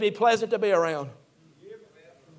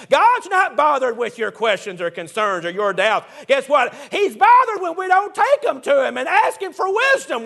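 preaching; 5 semitones higher than usual. Acted. Preacher telling the congregation God wants questions, rising preaching. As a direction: drive it home to the church.

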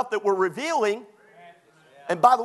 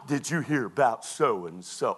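low, slow mock gossip voice; online gossip; 10 semitones lower, 3 dB quieter than usual. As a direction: drop low and draw it out like spreading a rumor. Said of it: "Get on your Facebook".

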